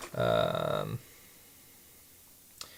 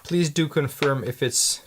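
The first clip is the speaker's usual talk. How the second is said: usual speaking voice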